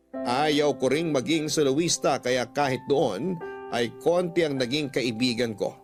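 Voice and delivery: usual speaking voice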